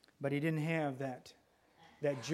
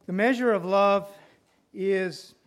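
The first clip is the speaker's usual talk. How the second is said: louder, higher proclaiming; preaching emphasis; 4 semitones higher, 9 dB louder than usual. Acted. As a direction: proclaim it to the whole hall.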